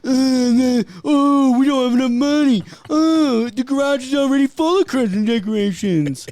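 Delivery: mock the nagging wife; mocking old lady. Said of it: "how your old lady mocks you".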